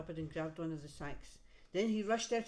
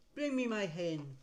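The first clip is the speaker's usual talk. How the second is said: giant's order; 5 dB louder than usual. Acted. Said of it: "heard him tell his wife".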